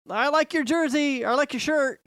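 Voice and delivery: put-on high, eager kid voice. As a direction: chirp it like a young kid. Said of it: "And then one of 'em".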